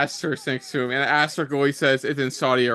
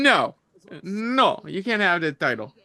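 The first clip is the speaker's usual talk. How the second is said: lightly put-on Italian accent; a hint of an Italian accent, higher; 4 semitones higher than usual. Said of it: "it's an Italian accent".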